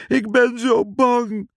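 frightened helper wailing in the storm; tearful fear. A narrator's reading. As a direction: let the fear crack into a cry. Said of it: "huilde Piet".

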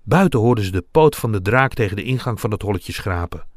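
usual speaking voice

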